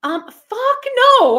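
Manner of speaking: real outraged no, slowed right down; emphatic refusal. Real, not acted. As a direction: stretch out a loud, high "fuck no". Emphatic refusal about living with man.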